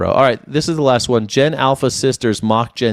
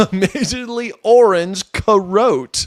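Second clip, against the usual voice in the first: drawn-out, higher-pitched mock of an influencer girl; slow, high, amused imitation; mimicking the girl; 6 semitones higher, slower than usual. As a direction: echo her put-on voice, stretching the words. Mimics the girl's clip just played.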